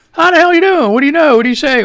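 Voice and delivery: loud, high and hearty hello; hearty greeting. Real, not acted. Host hollering a cheery hello to his listeners. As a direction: slap them on the back with it.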